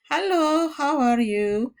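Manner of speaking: an English Hello with a breathy h; English accent. Shown as a demonstration. Teacher imitating English to show an aspirated h. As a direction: say Hello, how are you like an English speaker. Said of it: "como el inglés".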